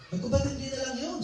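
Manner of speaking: a hushed, lifted lament; grieving bitter plea. Retold or imitated. Preacher retelling his plea to God after his father died, soft high lament.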